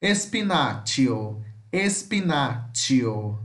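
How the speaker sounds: espinaccio with a tch sound, said slowly; slow model word. Shown as a demonstration. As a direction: stretch espinaccio out, tch in the middle. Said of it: "fica com o som de X com T".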